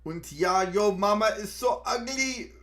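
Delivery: high, loud, hammed-up German accent; clumsy mock German, belting out the joke; bad German accent. Shown as a demonstration. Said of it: "That's a bad German accent".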